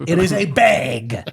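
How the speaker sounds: "bag" drawn out with a long Minnesota vowel, slowly. Shown as a demonstration. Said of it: "we're A".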